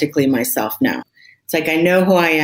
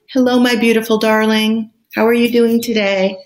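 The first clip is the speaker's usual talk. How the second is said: higher, sweet coo like talking to a beloved pet; tender pet voice; 5 semitones higher than usual. Shown as a demonstration. Talking gently to herself like to a pet, tender coo. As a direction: coo it the way you'd greet your dog. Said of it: "same voice you'd use with your pets".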